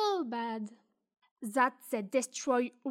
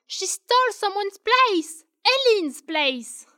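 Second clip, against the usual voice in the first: indignant accusation; 7 semitones higher, 8 dB louder than usual. Acted. Accusation reaching its peak.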